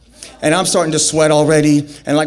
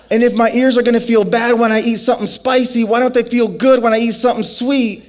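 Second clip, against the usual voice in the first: frantic rant; 6 semitones higher than usual. Retold or imitated. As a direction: ramble in burning panic. Rambling while burning from pepper.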